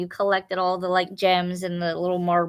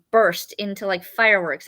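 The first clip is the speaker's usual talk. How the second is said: usual speaking voice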